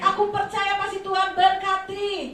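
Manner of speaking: loud, high preaching proclamation; preacher leading the congregation in confession; faith declaration. Shown as a demonstration. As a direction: declare it boldly at full force. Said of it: "Saudara ucapkan".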